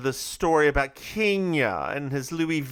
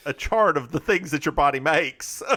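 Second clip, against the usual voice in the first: ordinary talk, nothing marked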